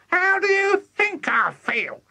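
high-pitched furious granny; high, indignant granny yell; yelling Grandma. A narrator's reading. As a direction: screech it angrily like a tiny old woman. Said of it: "she yelled".

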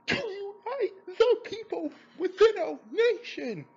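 high, loud, overwrought stress on random words; weird emphasis. Retold or imitated. Reviewer mocks the actor's odd line delivery, high and loud. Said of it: "placed weird emphasis on certain words".

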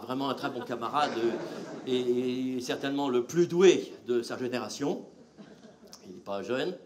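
low, soft introduction of the next act; stage introduction. Real, not acted. Comedian introduces his friend to the audience, soft and low. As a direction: hand over the stage gently.

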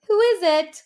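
high, loud little pig call. Acted. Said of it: "called the second pig".

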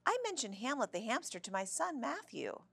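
high-pitched chatty cheer; cheery older lady sharing news. A narrator's reading. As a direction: chat on cheerily, pitch well up. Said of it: "in her loud, cheery voice".

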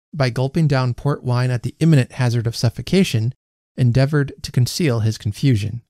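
usual speaking voice

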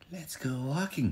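a gentle mother bear calling the family out; Mama Bear. Acted. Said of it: "she said".

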